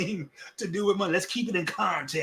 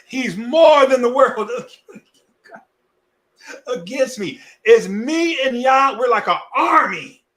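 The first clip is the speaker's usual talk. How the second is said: higher, louder and slow, a triumphant cry; preaching shout; 7 semitones higher, 9 dB louder, slower than usual. Acted. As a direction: proclaim it like a battle cry, rising high. Preaching climax, quoting grandmothers' faith.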